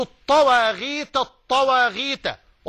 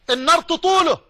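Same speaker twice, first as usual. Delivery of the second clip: raised alarm; 6 semitones higher, 4 dB louder than usual. Acted. Describing fire reaching the scratched.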